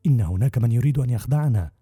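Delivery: plain reading voice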